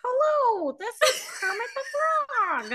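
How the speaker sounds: very high cartoon frog voice; squeaky frog impression; Kermit the Frog. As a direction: greet callers as a high-pitched puppet frog. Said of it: "they got a hold of Kermit".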